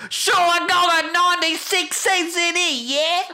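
high-pitched mock British accent; wife's British impression. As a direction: imitate a terrible British impression, squeakily. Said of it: "her British impression is, like".

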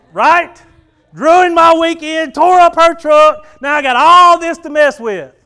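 high, loud, slow comic fury; shouting mock-angry complaint; hammed-up outrage. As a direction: rant like your weekend was stolen. Preacher venting about wrecked truck.

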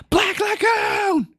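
very high, slow shout; shouted title. Shown as a demonstration. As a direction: yell it like an anime title card. Echoing the all-caps shout.

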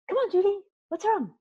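gentle coach. Retold or imitated. Coach to exhausted trainee, gentle coaxing. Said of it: "looked at me really calmly".

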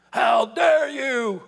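slow, loud, indignant; hammed-up how-dare-you; mock offended shout. Preacher mocking offended churchgoers, slow indignant shout.

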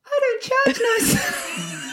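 high, slow, shouted put-on accent on "I don't charge no"; over-the-top accent. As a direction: belt out "I don't charge no" in a huge silly voice. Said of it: "was something like this?".

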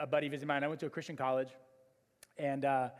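ordinary talk, nothing marked

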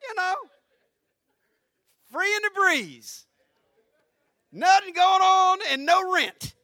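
loud, high, stretched-out preaching; preaching at full force. Acted. Pastor preaching to his church, loud and high. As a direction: drive each line home to the congregation. Preaching about Adam in paradise.

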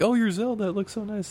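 high, sugary fake praise; mocking gushing voice. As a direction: mimic a gushing fan. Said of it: "They're like".

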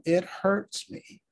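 ordinary delivery

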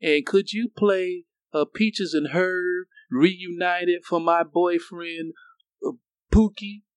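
high-pitched, slow, hesitant caller; imitated radio caller. Listener calls in a dedication for boyfriend, imitated caller voice. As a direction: drag out a song dedication in a high voice. Acted caller requesting a song.

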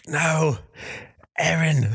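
high, hammed-up movie-hero mourning; mock grief. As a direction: cry out over a fallen comrade. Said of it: "It's a real tearjerker".

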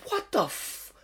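high, cut-off gasp of disbelief. Retold or imitated. Comic recalling his walk up to a hostile crowd, disbelieving. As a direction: let it fade before the swear finishes.

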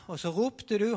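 usual speaking voice

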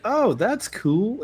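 lightly voiced old man, high and slow; a hint of a polite old man, drawn out slow; high mock old man. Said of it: "And he goes".

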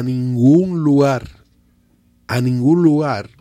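"ningún lugar" slow and loud, weight on each word; emphatic stress. Real, not acted. Emphatic repeated denial of invasion.

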